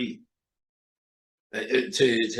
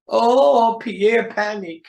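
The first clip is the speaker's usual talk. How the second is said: swooning; 11 semitones higher, 6 dB louder, much slower than usual. Acted. Woman swooning at French talk.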